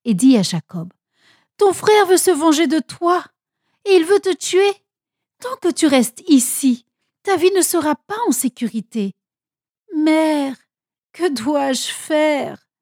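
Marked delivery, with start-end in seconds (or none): 1.6-9.1 avertissement inquiet, voix haute; préviens ton fils d'une voix aiguë et alarmée; grande peur. A narrator's reading. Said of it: "elle eut très peur". 10.0-12.7 désarroi. A narrator's reading. Fils menacé implore sa mère.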